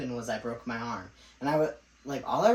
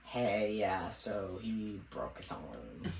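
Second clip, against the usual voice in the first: low, sheepish, slow-trailing mock confession; awkward phone call; 5 semitones lower, 5 dB quieter, much slower than usual. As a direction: imitate dad reluctantly breaking bad news, voice dropping. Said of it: "make the awkward phone call".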